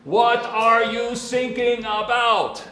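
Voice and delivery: put-on high, loud, slow German-accented question; mock German accent. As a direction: repeat the radio operator's drawn-out German question. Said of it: "Jesus poses to Peter this question".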